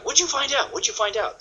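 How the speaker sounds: high-pitched, eager questioning; curious croc brain. Acted. The listener's subconscious wants to know, high and curious. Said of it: "That stops the croc brain right in its path".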